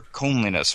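COMELINESS with a long "oh", as in "comb"; alternative pronunciation. Shown as a demonstration. Host offers another variant, COMELINESS said "KOHM-lee-ness". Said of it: "however it's pronounced".

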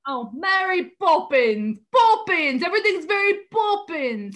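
overdone mock Cockney, rising in pitch. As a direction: punch out a bad British accent. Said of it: "just him saying Mary Poppins".

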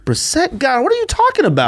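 squeaky, incredulous high pitch. Real, not acted. Disputing an injury with the co-host, incredulous. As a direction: let disbelief send the voice sky-high. Disputing whether the quarterback was hurt.

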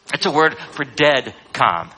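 usual speaking voice

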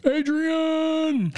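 high, long drawn-out call; Rocky yelling Adrian. Retold or imitated. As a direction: bellow it like Rocky. Rocky quote called out.

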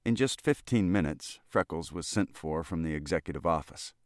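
ordinary delivery, nothing marked

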